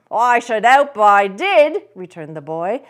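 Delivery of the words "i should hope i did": loud high lad's reply; street boy. Acted. The boy answering Scrooge from the street, cheeky. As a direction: call back up cheekily.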